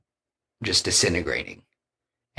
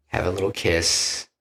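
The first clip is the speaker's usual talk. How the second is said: ordinary talk, nothing marked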